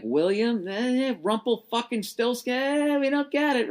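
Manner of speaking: mocking confused clerks. Mocking clerks misreading his name.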